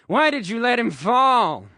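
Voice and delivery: loud, high accusing complaint; nagging. A narrator's reading. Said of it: "Harmony nags Harry".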